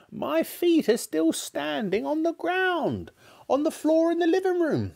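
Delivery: high, cackling crone's voice; cackling old woman. Acted. Grandma teasing George's mother, high and cackling. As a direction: cackle it like a gleeful old witch. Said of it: "cackled the old woman".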